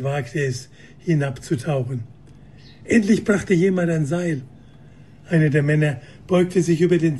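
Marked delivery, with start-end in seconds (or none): none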